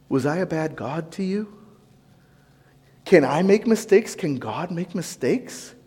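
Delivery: put-on hushed, grieving God speaking to a wayward child; soft and sorrowful, as a disappointed father. God quietly asking his wayward child why, in a hushed, pained voice.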